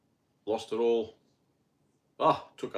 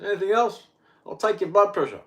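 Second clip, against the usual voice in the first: higher, louder quick doctor; brisk doctor; 9 semitones higher, 6 dB louder than usual. Retold or imitated. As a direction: rattle it off like a busy GP. Doctor voiced in retelling.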